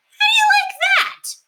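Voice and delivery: put-on affronted kid's outcry; Robert outraged at the prank; indignant boy. Boys find owl pranked.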